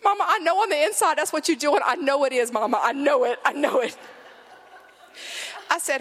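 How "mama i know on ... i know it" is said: put-on high, insistent child, near tears; pleading, pitched-up kid accusation; earnest little boy. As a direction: imitate a small kid dead serious with mom. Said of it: "he's so serious".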